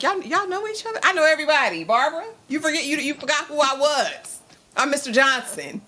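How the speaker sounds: high, lightly voiced brag; mock Mister Johnson. Lightly voiced Mister Johnson bragging to Barbara. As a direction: boast like he knows everyone. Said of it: "I'm like".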